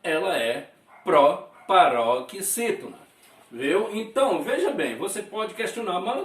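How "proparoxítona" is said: proparoxítona slow and loud, broken into pieces; syllable-by-syllable emphasis. Shown as a demonstration. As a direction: spell out proparoxítona chunk by chunk. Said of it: "antepenúltima sílaba é pronunciada". (proparoxítona devagar e forte, pausada)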